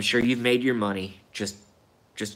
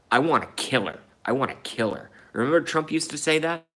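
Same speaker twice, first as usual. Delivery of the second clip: usual speaking voice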